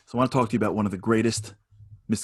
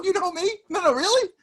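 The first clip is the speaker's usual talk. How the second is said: squeaky, much higher disbelief; amazed villager; 4 dB louder than usual. Pretend. Villager stunned that the king knows him, much higher. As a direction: gasp it out in wide-eyed disbelief.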